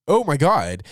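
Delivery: loud, high burst of shock; shocked oh my god. Real, not acted. Reacting to the [name] car news, loud shock. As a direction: blurt it out, stunned.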